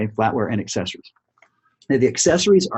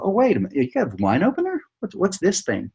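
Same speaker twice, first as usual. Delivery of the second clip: higher, surprised question; surprised customer; 9 semitones higher than usual. Retold or imitated. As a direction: react like a curious customer. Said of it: "they'll go".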